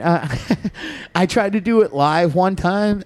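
ordinary talk

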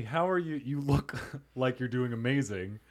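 ordinary talk

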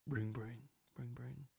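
softly voiced phone ringing; hushed ring-ring; imitated phone ring. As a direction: mimic a distant ringtone, fading very soft. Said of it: "I hear my phone ring".

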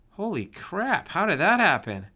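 high, loud burst of shock. Acted. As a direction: gasp it out in disbelief. Shocked boy sees healed wound.

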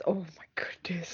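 barely audible whisper, exasperated; whispered disbelief. Real, not acted. Host whispering in disbelief at a panelist's romance pick. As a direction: breathe it out in stunned disbelief.

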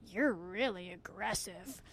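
slow, lightly put-on voice; imitating the man. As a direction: draw out a mock-offended reply. Voicing the man's reply.

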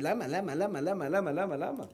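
mock whining repeated why; whiny complainer. God mocking the complainer's lightly whined why. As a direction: mimic a mild whiny moan. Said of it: "תפסיק להתבכיין".

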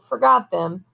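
usual speaking voice

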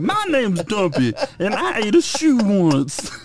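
very high, slow goofy voice with laughter; Dumpy voice. Retold or imitated. Retelling Dumpy's only line, high and slow. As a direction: pitch way up and drag it out like Dumpy. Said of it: "He was just like".

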